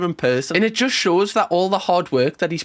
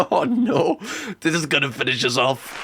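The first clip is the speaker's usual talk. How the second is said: ordinary talk, nothing marked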